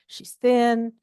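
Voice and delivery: usual speaking voice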